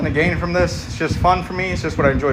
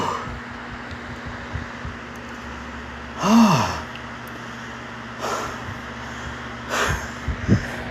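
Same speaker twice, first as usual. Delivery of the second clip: long winded exhale; 2 semitones lower, 7 dB quieter than usual. Real, not acted. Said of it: "My cardio is so out of there".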